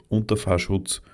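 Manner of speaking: usual speaking voice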